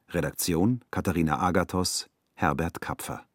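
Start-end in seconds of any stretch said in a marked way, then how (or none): none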